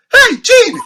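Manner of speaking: very high, loud excited call; mock high-pitched holler; high voices. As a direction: imitate a squeaky-high fan shouting hello. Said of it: "we have this high, you know, voices".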